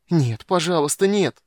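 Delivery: high, loud anguished begging; moaning plea. A narrator's reading. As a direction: beg as your power drains away. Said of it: "застонал юноша".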